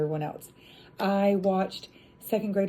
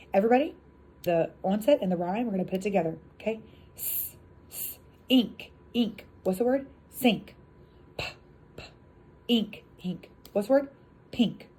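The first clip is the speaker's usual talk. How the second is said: teacher demo voice. Shown as a demonstration. Teacher leading third graders in blending, upbeat. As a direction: coax the class through blending. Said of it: "That's when you would do the".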